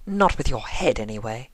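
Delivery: dry cutting jab, fading off; scathing retort. Acted. Rarity needling Rainbow, scathing jab. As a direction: slip the insult in coolly. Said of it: "Rarity returned scathingly".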